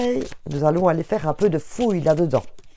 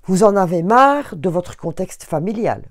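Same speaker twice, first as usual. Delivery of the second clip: ordinary talk, nothing marked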